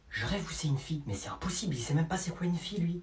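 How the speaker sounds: low, very soft muttering; incredulous aside. Acted. Eavesdropper doubting it's a girl, hushed incredulous aside. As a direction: reason it out without being overheard.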